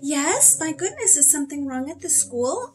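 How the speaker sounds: high, worried questioning; loud, high-pitched fretting on the phone; alarmed mother. A narrator's reading. Anxious mother answers school's phone call.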